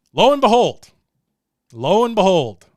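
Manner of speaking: loud, high dramatic reveal; lo and behold. Real, not acted. Telling viewers the appeal arrived, loud dramatic reveal. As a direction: announce it like a big twist.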